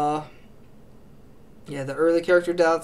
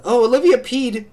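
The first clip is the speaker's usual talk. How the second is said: put-on tattling girl; 6 semitones higher, 7 dB louder than usual. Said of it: "they're like".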